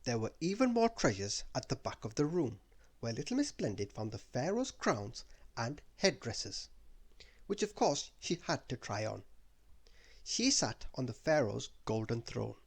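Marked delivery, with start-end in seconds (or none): none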